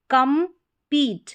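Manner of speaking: Compete slowly as kam, pause, peet; slow, spaced compete for learners; split into syllables. Shown as a demonstration. Teacher splits word, then blends.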